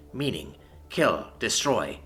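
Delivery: ordinary delivery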